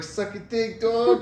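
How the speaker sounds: put-on high, cheery New York accent. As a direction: say it bright and laughing. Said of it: "sounded, like, cheery".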